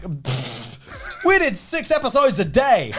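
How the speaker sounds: scoffing laugh, high and proud; laughing brag. Retold or imitated. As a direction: brush it off with a laugh. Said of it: "We were like".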